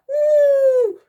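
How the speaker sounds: loud, high mammoth hoot; high whoop. A narrator's reading. Mammoth as a shot skims her head, high whoop. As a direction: let out a startled woo.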